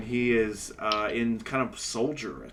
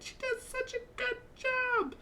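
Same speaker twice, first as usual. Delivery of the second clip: very high, gushing praise; flamboyant half-elf; 3 dB quieter than usual. Acted. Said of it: "taps him on the forehead".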